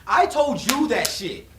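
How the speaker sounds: loud, high, furious scolding; retold anger. To his friend who told his girl, furious scolding. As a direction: chew out the friend hard.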